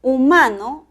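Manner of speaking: emphatic teaching demo of "Humano", hu-MA-no; middle-syllable stress. Shown as a demonstration. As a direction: hit MA in "Humano". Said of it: "el acento prosódico está en la sílaba".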